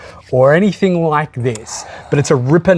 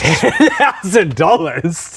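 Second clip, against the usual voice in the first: slow, laughing incredulous question; disbelief; 3 semitones higher, much slower than usual. Real, not acted. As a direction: laugh at the absurd price. Hearing an outrageous demand.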